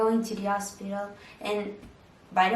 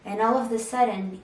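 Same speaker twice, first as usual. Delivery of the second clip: normal talking voice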